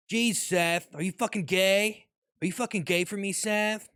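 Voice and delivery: Rick impression. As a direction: sneer it like a drunk mad scientist. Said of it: "this Rick and Morty improv I got going on".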